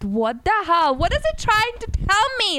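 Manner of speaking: re-enacted bafflement, pitch climbing high; baffled outburst. Retelling her reaction to the angel card, baffled. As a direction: act out rising disbelief at a puzzling card.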